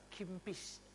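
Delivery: put-on soft Makassar-accented "kimpis"; logat Makassar. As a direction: repeat "kimpis" softly as a Makassar speaker would. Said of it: "istri saya orang Makassar". ("kimpis" pelan berlogat Makassar)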